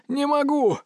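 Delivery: very high, strained wail; desperate cry. A narrator's reading. Wounded medic to commander, high desperate cry. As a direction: cry it out high, without full volume.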